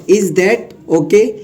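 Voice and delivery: "Is that okay" spoken very slowly, each word drawn out for learners; slow teaching read. Shown as a demonstration. Teacher slowly dictating the phrase to students. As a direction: spell it out slowly so students can copy it.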